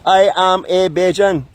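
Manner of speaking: slow, high proud Bajan call; Bajan declaration. Shown as a demonstration. Vlogger leading viewers in a chant, slow proud high call. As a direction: lead the viewers in saying it with Bajan flair. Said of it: "So say it this morning with me".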